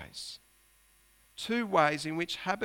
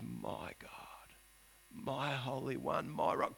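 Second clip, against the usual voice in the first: whispered, lower, intimate; hushed prayer; 3 semitones lower, 7 dB quieter, slower than usual. Acted. Preacher voicing Habakkuk's hushed prayer, whispered and lower. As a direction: breathe the names of God. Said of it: "he refers to God as".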